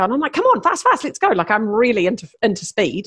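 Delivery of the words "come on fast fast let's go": high, urging push to go; mock impatient urging; urgent hurry. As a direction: hurry her along, keen and impatient.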